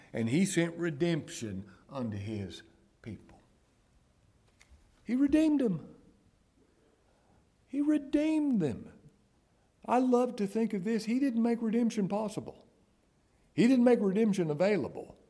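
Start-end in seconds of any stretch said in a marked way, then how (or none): 5.0-15.2 high, insistent pulpit voice; impassioned preaching. Real, not acted. Preacher exulting to the congregation in a finished redemption, impassioned. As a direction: preach it high, stretching the key words.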